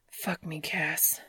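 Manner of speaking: soft, breathless gasp of words; almost whisper it, out of breath. Acted.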